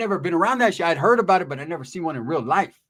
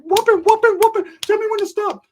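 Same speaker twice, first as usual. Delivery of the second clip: much higher, louder squeaky gleeful chant; mock Ricky voice; 6 dB louder than usual. Narrator voicing Ricky beating the older dude. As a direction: chant it high like a gleeful goof.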